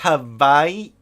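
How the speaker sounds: Hawaii said slowly as "Ha-vai-ee", a V where the W is written; slow, deliberate "Ha-vai-ee" for Hawaii. Shown as a demonstration. Correcting himself for the viewers, slow "Ha-vai-ee" for Hawaii. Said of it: "it's pronounced Hawaii".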